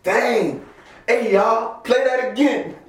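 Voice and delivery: high-pitched, mock eager plea; retold eager request. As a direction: act out begging to replay a favourite song. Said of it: "I'm like".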